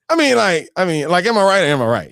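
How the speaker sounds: Italian gangster movie talk. Retold or imitated. Teasing the TSU students, a mock mobster line. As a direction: toss it off like a movie wiseguy. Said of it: "You know, that's how they talk".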